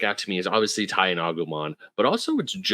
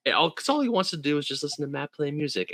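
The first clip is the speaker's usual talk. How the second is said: usual speaking voice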